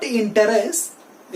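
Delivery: interest in three syllables, in-te-rest; wrong pronunciation. Shown as a demonstration. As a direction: sound the middle e in interest. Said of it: "It is not". (interest का गलत उच्चारण, इन-टे-रेस्ट)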